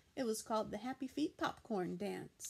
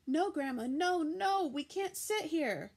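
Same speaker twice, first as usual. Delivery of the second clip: loud, high alarmed protest; frightened girl warning grandma off the bed; panicky; 6 semitones higher, 6 dB louder than usual. A narrator's reading. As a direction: pull her away in alarm. Panicked child.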